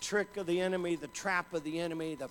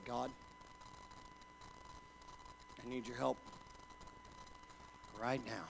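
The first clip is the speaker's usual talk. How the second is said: softer, lower, barely voiced prayer; quiet plea; 4 semitones lower, 11 dB quieter than usual. Alone in a bean field, praying quietly to God for help. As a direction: pray it softly, alone in the field.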